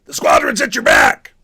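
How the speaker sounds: high, loud commanding shout; rally the squadron over the noise; full-volume multiplayer callout; battle shout. Shown as a demonstration. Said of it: "taking this voice that was in here and going".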